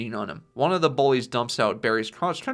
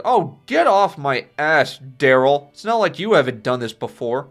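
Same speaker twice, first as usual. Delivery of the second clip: angry snap; 8 dB louder than usual. Retold or imitated. Drunk son snapping at father.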